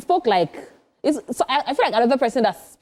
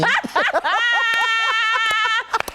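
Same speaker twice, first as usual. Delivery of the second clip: long high laugh; 13 semitones higher than usual. Real, not acted. Host reacting to the big three answer, laughing hard. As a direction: crack up in a drawn-out high cackle.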